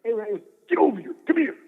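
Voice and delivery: high, rushed wrestler bark; amped-up wrestler impression. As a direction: beckon him over like a hyped-up wrestling star. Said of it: "he really talked like that".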